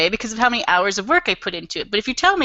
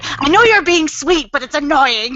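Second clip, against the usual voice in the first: higher, louder exasperated outburst; hammed-up annoyed plea; mock exasperation; 6 semitones higher, 5 dB louder than usual. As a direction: play fed up with a sweet answer. Acted quarrel over an honest answer.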